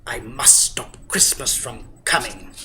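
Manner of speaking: whispered Grinch scheme; a scheming whisper; sinister whisper. Acted. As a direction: hiss it low like a plotting villain. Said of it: "he growled".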